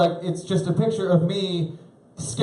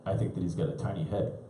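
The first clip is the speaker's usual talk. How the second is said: put-on hushed conspiratorial kid; 7 semitones lower, 10 dB quieter than usual. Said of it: "She's like".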